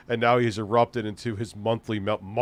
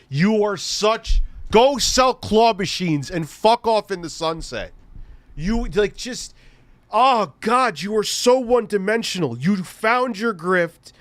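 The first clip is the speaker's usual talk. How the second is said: exasperated rant; 9 semitones higher, 4 dB louder than usual. Real, not acted. Ranting at a troll in chat.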